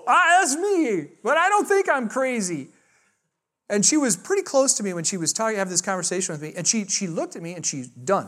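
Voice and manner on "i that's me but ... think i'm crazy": high, drawn-out retold protest; hammed-up objection; mock indignant. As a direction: stretch it out high in mock offence. Retold protest at being called crazy.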